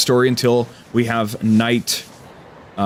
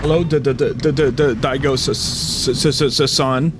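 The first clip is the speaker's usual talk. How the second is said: repeated-consonant stammer; mock stutter; 4 semitones higher than usual. Player greets Daigo, exaggerated stutter. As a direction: stammer on the name and honorific.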